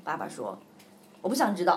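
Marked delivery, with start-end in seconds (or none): 1.2-1.8 louder, curt king brushing it off; a cross father says no; mock grumpy king; grumpy refusal.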